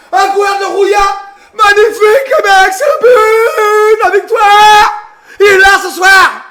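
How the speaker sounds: loud, high, crying out with joy; ecstatic goal shout. Real, not acted. Fan celebrating Marseille's goal with the stream, ecstatic roar. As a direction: roar it like the winning goal just went in.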